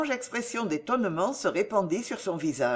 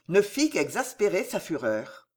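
ordinary delivery

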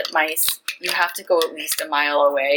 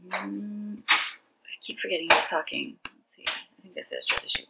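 quiet aside; 8 dB quieter than usual. Real, not acted. Aside to herself while reading.

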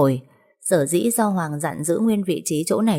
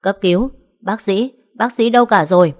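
booming shout; 3 semitones higher, 5 dB louder than usual. Acted. Brother carrying his bleeding sister in, shouting for a doctor. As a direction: yell across the hospital for help. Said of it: "Giọng cậu ấy oang oang".

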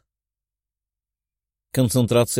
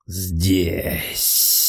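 snake hiss; 3 semitones lower than usual. A narrator's reading. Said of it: "прошипел демон, словно змей".

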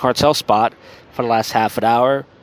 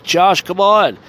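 imitating a drunk guy calling out, higher; louder mock appeal to a friend; 7 semitones higher, 5 dB louder, much slower than usual.

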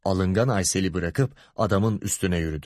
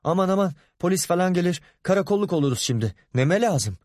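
higher, hurried worry; mildly panicky, pitched up; flustered; 6 semitones higher, faster than usual. A narrator's reading. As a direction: fret while getting away from trouble. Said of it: "diyerek telaşla uzaklaştı".

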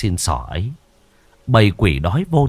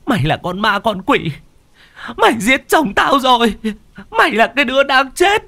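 yelling; 14 semitones higher than usual. A narrator's reading. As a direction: scream accusations at the ghost child. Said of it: "mà quát".

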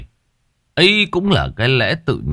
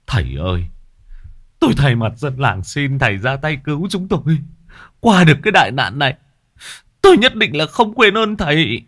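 pleading; 7 semitones higher than usual. A narrator's reading. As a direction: beg the master desperately. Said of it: "lạy lục". (van nài khẩn khoản)

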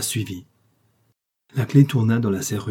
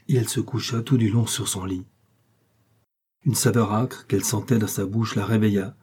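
usual speaking voice

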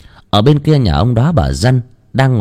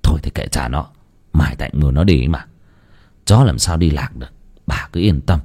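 put-on placid old husband; 8 semitones lower than usual. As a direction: shrug it off, deep and easy. Ông chồng trấn an vợ. (giọng ông lão trầm, nhẹ nhàng trấn an)

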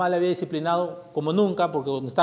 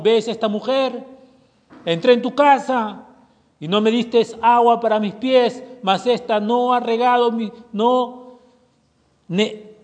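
raised, forceful reading of the rebuke; preaching scripture; 2 semitones higher, 6 dB louder than usual. Acted. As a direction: proclaim it with rising fervor. Preacher reads Jesus' rebuke. (lectura bíblica enfática y fuerte)

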